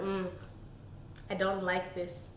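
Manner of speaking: soft, low, wary mm; quietly disapproving; uneasy murmur. Retold or imitated. Reviewer reading the angry drawing, soft uneasy murmur. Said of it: "And I was like".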